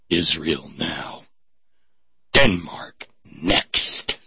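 low, slow, grim announcer read; ominous slogan voice. Acted. Reading the poster slogan as a grim low trailer voice. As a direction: intone it like a menacing movie trailer.

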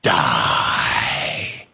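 deep booming alien command; low menacing creature shout. Retold or imitated. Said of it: "And the alien says".

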